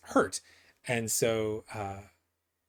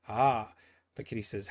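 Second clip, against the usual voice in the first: ordinary talk, nothing marked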